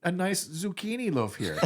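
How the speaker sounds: lightly put-on German accent, high, laughing through it; a hint of a German guy offering cake. Assistant offering Johnny zucchini bread in a mock German accent. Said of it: "like, a German guy".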